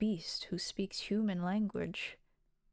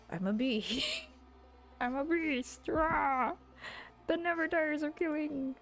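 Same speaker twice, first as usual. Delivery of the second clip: raised-pitch mock monster boasting; hammed-up scary beast; 6 semitones higher than usual. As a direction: play a monster trying too hard to frighten.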